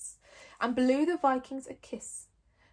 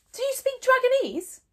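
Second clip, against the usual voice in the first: high, loud astonished question; surprised; 8 dB louder than usual. Acted. Chief Stoic astonished at Gobber, high and loud. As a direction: blurt it out in shock. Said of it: "in surprise".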